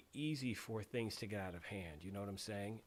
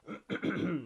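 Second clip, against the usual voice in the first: throat clearing; 5 semitones higher, 6 dB louder than usual. Real, not acted. Streamer coughing after choking on tea.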